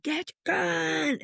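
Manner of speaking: a voice in her ear screaming at her to get the gun, high and drawn out; retold scream. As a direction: imitate a voice in her head screaming an order. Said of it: "this time it screamed".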